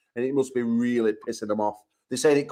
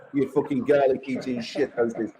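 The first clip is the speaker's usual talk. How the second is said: usual speaking voice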